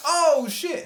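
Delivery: high, startled oh shit. Retold or imitated. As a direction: caught off guard. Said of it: "And I went".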